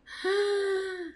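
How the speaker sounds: sharp high gasp of shock; retold startled gasp. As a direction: gasp as if seeing a ghost. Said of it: "Y yo de repente la veo".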